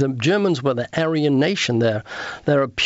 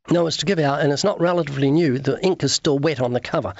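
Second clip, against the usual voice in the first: ordinary talk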